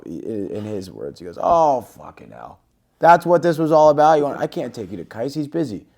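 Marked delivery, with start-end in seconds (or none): 1.4-5.9 exasperated outburst, voice jumping up loud. Retold or imitated. Steve grumbles at being asked to introduce him to Kais, exasperated. Retold exasperated reply to his request.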